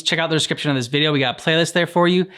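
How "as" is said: ordinary talk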